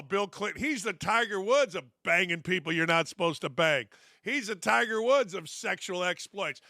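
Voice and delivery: usual speaking voice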